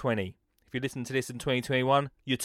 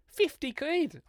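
mock surprise; 13 semitones higher, 4 dB quieter, slower than usual. Mock excited price shout.

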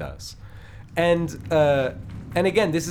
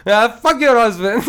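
louder mock-aggressive snap, laughing through it; aggressive retort; 7 semitones higher, 9 dB louder than usual. Guy at the pizza line brushes off the husband, loud mock aggression. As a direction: fling it back hard, half laughing. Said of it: "And then he goes".

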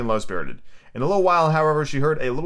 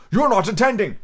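higher, severe mouse rebuke; severe scolding; 9 semitones higher than usual. A narrator's reading. The mouse catches Alice not listening, severe. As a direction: scold sternly, pitched up. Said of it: "said the mouse to Alice severely".

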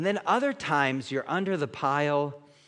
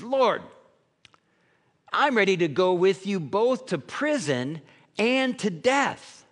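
much higher and louder, eager; bold Peter; 6 semitones higher, 4 dB louder than usual. Retold or imitated. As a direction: swear it like boastful Peter. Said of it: "Peter says".

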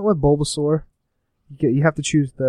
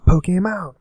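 breathy excited whisper; 5 semitones higher, slower than usual. Real, not acted. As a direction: whisper the name in awe. Excited whisper of game name.

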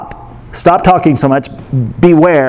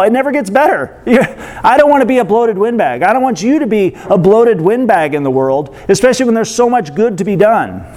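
ordinary talk, nothing marked

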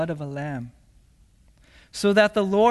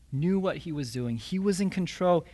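ordinary talk, nothing marked

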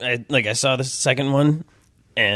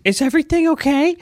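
a much higher, worried wife's voice; mock wife; 3 dB louder than usual. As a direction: squeak it up like the anxious wife at the door.

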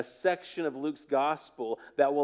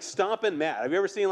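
ordinary delivery

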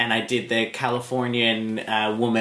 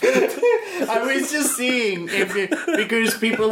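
words breaking into laughter; giggling still; laughing while talking; 10 semitones higher, slower than usual. Real, not acted. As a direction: talk through a laugh.

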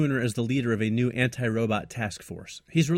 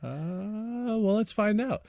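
high voice; 11 semitones higher, much slower than usual. Acted. Playful teasing reply.

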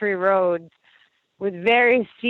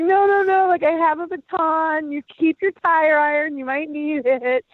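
laughing refusal; 7 semitones higher than usual. Retold or imitated. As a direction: wave it off, amused. Refusing tire iron, laughing.

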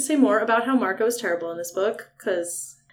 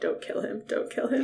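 hushed whisper, much softer than her talk; mock whisper to himself; whispered aside; 11 dB quieter than usual. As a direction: breathe it under your breath as Tobias. Tobias quoted under his breath.

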